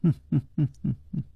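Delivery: quick soft nasal titter; rapid hummed laugh; coy giggle. A narrator's reading. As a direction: giggle teasingly through the nose. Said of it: "而且还笑了笑说".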